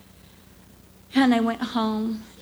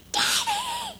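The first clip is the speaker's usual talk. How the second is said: put-on child's cry. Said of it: "those children go".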